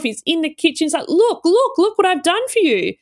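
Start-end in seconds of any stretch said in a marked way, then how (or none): 1.1-2.9 high, eager mimicry of a proud husband; excited, proud. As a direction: show off a surprise, pitched well up. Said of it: "John is so excited".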